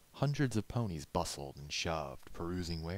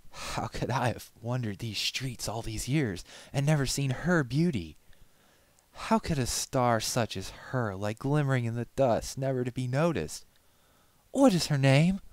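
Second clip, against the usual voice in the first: loud, rising high, rapturous; building to a swooning cry; lovestruck outburst; 7 semitones higher, 9 dB louder than usual. Acted. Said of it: "He took a shaky breath".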